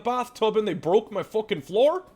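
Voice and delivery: high-pitched butcher in indignant disbelief; outraged butcher. Acted. Butcher complains guests broke his floor, outraged. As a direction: protest incredulously, pitched well up. Game master voices the butcher.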